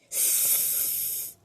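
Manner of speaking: soft breathy hiss; faint creepy hiss; eerie hiss. Acted. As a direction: hiss from under covers. Eerie reply.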